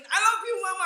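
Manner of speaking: "I love you" called out high and loud; the boy turns it up so mama hears. Retold or imitated. Boy shouting to mama to outdo his brother, high and loud. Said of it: "mereka kencengin".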